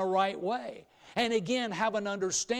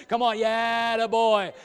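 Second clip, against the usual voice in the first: louder, higher "Come on, yeah, attaboy"; rally the crowd to join in; cheering on; 3 semitones higher, 7 dB louder than usual. Shown as a demonstration. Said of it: "Come on, Tim".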